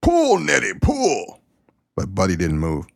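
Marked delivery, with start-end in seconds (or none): 0.0-1.4 high, hammed-up hollered command to the horse; mock-yell it at the horse like a farmer; farmer's yell.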